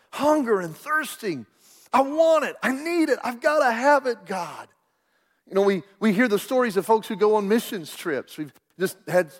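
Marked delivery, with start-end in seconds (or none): 1.9-4.7 hungry pleading. Acted. Acts out hungering and thirsting.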